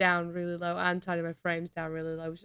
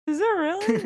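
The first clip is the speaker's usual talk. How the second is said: high, louder amused disbelief; surprised; 12 semitones higher, 6 dB louder than usual. Real, not acted. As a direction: burst out in surprise, half laughing. Hearing about angry subreddit.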